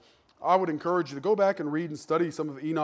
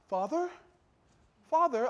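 high-pitched British questioning call; British accent; 9 semitones higher than usual. Acted. Son calling for his vanished father, mock British. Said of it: "I don't know why they're all, uh, British".

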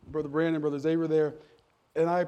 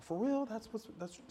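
put-on disappointed teenager; 4 semitones higher, 10 dB quieter than usual. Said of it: "Some of the teenagers just got disappointed".

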